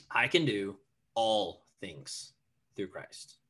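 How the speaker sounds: "all" drawn out slowly, set apart by pauses; emphasis on different words. Shown as a demonstration. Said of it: "I would emphasize different words".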